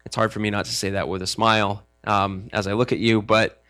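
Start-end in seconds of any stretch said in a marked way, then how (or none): none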